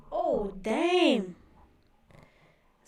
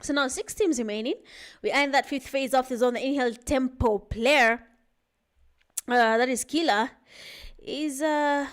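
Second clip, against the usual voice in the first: energetic live esports caster; play-by-play commentary; 3 semitones higher, 3 dB louder than usual. Acted. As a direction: call the match as it happens.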